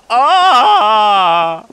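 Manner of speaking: loud high yell of shock; startled shout; shocked scream. Retold or imitated. Shocked reaction in retold story.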